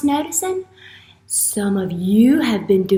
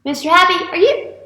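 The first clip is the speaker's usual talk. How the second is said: higher-pitched short call; tentative calling; 6 semitones higher than usual. Pretend. Teacher calls at the puppet's door, lightly. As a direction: call lightly to see if anyone answers.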